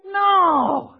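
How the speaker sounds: high, loud preacher's shout; fired-up, insistent proclamation; emphatic protest. Real, not acted. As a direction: cry it out with full conviction. Preacher refuting fear of feelings.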